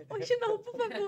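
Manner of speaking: súplica cansada e aguda, em tom de brincadeira; pedido exasperado. Retold or imitated. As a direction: implore alto, meio de brincadeira. Pede para não ouvir música. (pedido exasperado e agudo, em tom de brincadeira)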